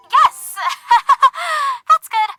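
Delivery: high-pitched burst of laughter; laughing. Acted. Nervous caller amused by the friend on the line, laughing. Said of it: "Thank you for making me laugh".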